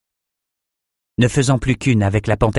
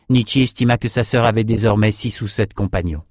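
usual speaking voice

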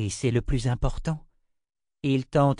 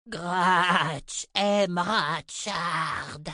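very slow, strange creature voice dragging out aime; growling creature; 7 semitones higher, much slower than usual. A narrator's reading. The garne telling the girl it loves Richard, slow creature growl. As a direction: drag each word like a beast learning speech. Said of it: "grogna-t-il".

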